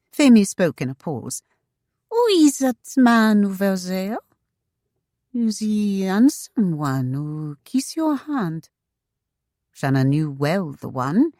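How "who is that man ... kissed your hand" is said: put-on intrigued, drawn-out French accent; lilting French-accented lady; vivacious French wife. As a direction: ask with accented curiosity, lingering on the words. Femi's accented voice in story.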